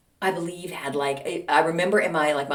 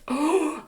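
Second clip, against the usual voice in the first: high, drawn-in gasp; shocked gasp; 9 semitones higher than usual. Acted. Storyteller's mock shock.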